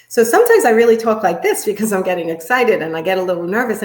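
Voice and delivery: fast and loud with jumpy pitch; excited, nervous rush. Shown as a demonstration. Coach showing how nerves change their talking, excited rush. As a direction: race through it in nervous excitement. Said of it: "I talk faster and I talk higher".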